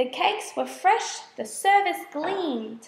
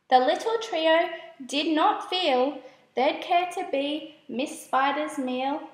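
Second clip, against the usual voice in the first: ordinary delivery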